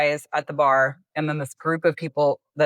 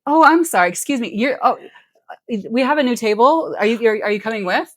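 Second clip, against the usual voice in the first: high-pitched, hammed-up polite interruption; feigned apology; 6 semitones higher, 4 dB louder than usual. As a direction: act out a rescuer pretending to apologize. Acting out friends' pretend table rescue.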